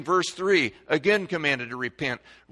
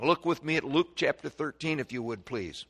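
usual speaking voice

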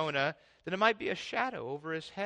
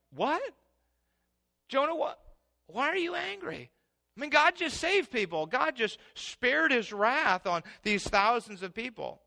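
high, incredulous what; can't believe Jonah is angry; baffled; 7 semitones higher, 3 dB louder than usual. Pretend.

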